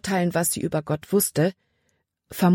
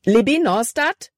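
higher-pitched, urgent call of the name; narrated alarm, a touch of panic; panicked; 7 semitones higher, 4 dB louder than usual. A narrator's reading. Caleb calls to Libby who cannot stop crying, panicked. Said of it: "Calebs Stimme war panikerfüllt".

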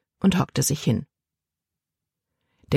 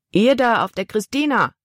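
higher-pitched hail to a ship; calling out; 6 semitones higher than usual. A narrator's reading. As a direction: call across the water to the crew. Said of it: "rief der große Mann". (laut hinübergerufen)

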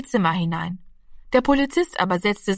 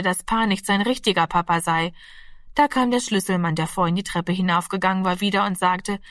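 ordinary delivery, nothing marked

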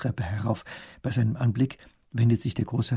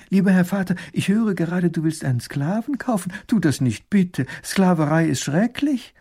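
higher, louder horrified cry; appalled plea; 7 semitones higher, 4 dB louder than usual. A narrator's reading. Princess pleading with her father, appalled. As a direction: beg her father, aghast. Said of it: "ruft sie entsetzt".

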